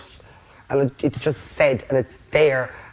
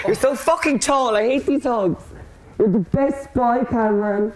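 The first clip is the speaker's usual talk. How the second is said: cooing affection; 5 semitones higher than usual. Real, not acted. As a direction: coo over him like a big puppy. Hugging Cameron, cooing affectionately.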